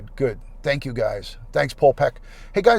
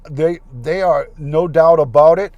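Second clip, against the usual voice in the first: mock Canadian accent; 8 dB louder than usual. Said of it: "I can't get that, uh, Canadian accent down".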